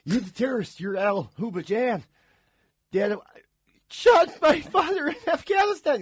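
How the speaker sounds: slow accusation building to a high, loud outburst; hammed-up TV drama. Parody of Homeland character accusing a terrorist, melodramatic. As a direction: overact it, drawn out and rising to a cry.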